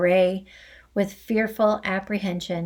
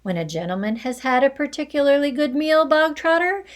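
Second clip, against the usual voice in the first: loud lecture; 6 semitones higher, 5 dB louder than usual. Acted. Trunchbull lecturing loudly.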